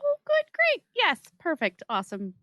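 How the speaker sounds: usual speaking voice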